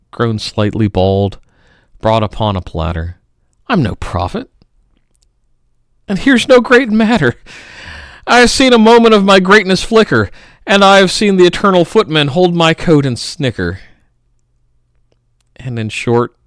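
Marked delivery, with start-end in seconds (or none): 6.1-13.8 much higher and louder, rushing faster; swelling declamation. Acted. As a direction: build it up, pressing ahead. Climax of recited poem.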